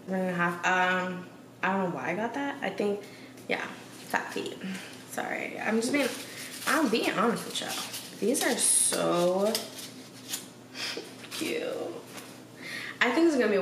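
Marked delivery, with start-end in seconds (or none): 5.1-7.7 whispered apology. Real, not acted. Apologetic aside to viewers.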